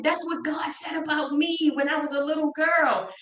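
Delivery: high, emphatic declaration; proud mother. Retold or imitated. Mother explaining her calling to daughter, high and proud. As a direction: proclaim it with conviction. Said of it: "But she said".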